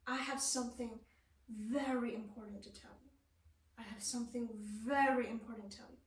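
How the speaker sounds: a prepared, weighty accent on VERY after a short wait; pause before VERY. Shown as a demonstration. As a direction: hold back, then land hard on VERY. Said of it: "I waited before I say very".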